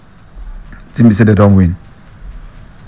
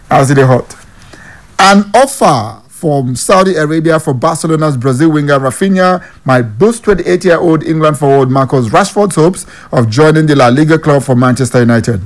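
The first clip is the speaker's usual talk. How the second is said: ordinary talk, nothing marked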